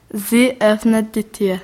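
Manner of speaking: ordinary delivery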